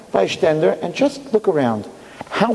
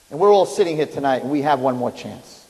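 ordinary talk

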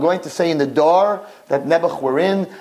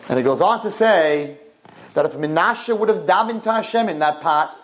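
normal talking voice

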